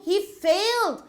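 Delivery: "failed" lifted high, "He" light; stress on failed. Shown as a demonstration. Teacher showing pupils the stress: high on "failed". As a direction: lean on "failed" with a falling tune. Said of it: "So the emphasis is on failed".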